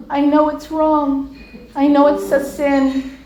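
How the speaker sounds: slow, drawn-out mock weepy voice; imitated tearful regret; retold tearful admission. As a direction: drag the words out like a crying friend. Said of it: "and she's crying".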